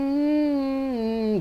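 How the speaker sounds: a high, drawn-out mock hum; imitating the eerie hum; imitated humming. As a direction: hum it like the ghostly voice in the mall. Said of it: "ganun siya".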